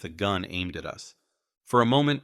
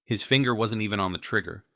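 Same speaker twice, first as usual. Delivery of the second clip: ordinary delivery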